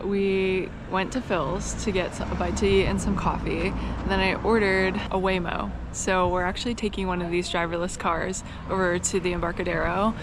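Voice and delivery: normal talking voice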